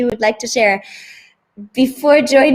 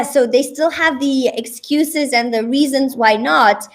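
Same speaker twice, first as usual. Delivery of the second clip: ordinary talk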